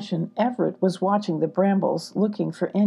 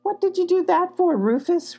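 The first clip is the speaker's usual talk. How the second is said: higher, louder complaint; complaining; 12 semitones higher, 4 dB louder than usual. A narrator's reading. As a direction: friend whining about the branch, complaining. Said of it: "Ruckus complained".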